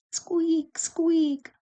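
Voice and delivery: very high squeaky lantern noise; high-pitched creak on each word; squeaking lantern. A narrator's reading. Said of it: "went the lanterns".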